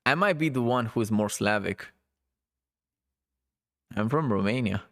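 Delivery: normal talking voice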